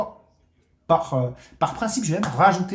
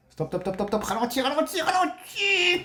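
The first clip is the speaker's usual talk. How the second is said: urgent pleading; 11 semitones higher than usual. Real, not acted. Streamer begging the wheel to slow, urgent pleading. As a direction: climb higher with each repeat, stretching the last.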